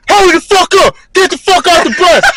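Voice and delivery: high, loud barked orders at recruits; drill instructor yelling. Retold or imitated. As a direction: scream it like a drill sergeant at the bus door.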